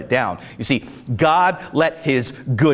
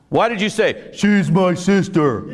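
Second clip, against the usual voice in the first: dumb guy voice. Retold or imitated. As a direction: say it like a dim-witted oaf. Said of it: "in the dumb guy voice".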